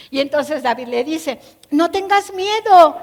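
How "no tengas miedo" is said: reassuring. Acted. King reassures frightened Mefiboset.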